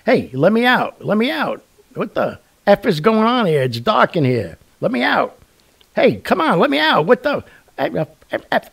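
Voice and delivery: high, loud mock yell to be let out; muffled yelling from coffin. A buried man yelling to be let out, hammed-up high shout. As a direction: bang and holler like you're trapped in a box. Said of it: "I heard banging and yelling like".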